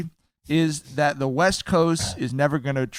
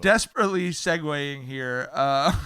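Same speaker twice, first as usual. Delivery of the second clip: normal talking voice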